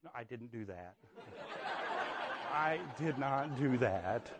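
soft laugh through the words. Real, not acted. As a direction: chuckle it off quietly. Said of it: "laughing disclaimer".

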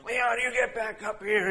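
high mock yell at a runaway kid; retold yelling. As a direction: call the boy back up the hill in a hammed-up shout. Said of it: "he's yelling at him".

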